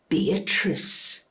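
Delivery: understated cold control; controlled menace. Acted. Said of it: "Never raised his voice, never needed to".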